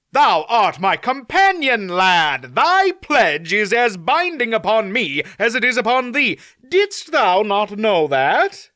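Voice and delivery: put-on a proud young knight puzzled by the question; courtly knight speaking firmly; earnest young knight. Mander Alan knight voice.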